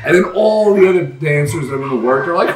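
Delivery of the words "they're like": usual speaking voice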